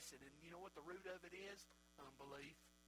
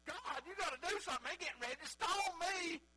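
loud, high preacher's imitation of Moses; desperate Moses pleading; 11 semitones higher, 9 dB louder than usual. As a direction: cry out to God in mock alarm. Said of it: "In fact, Moses said".

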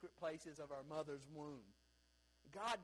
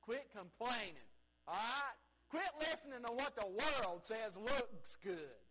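rising, louder preacher's push; preaching exhortation; 5 semitones higher, 5 dB louder than usual. Real, not acted. Pastor urging his church to quit complaining, raised preaching voice. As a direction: press the congregation to stop complaining.

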